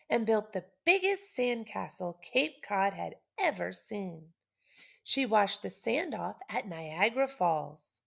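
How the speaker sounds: ordinary delivery, nothing marked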